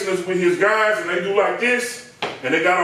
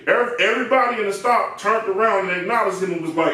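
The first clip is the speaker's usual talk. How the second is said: ordinary talk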